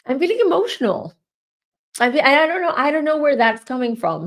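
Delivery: fighting back real tears; real emotion breaking through; welling up. Real, not acted. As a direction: voice rising high and fast, near tears. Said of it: "I feel emotional right now".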